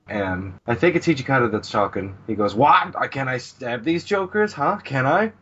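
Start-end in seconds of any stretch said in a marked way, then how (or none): 2.6-5.3 eager and worked up. Retold or imitated. Character eager to stab jokers.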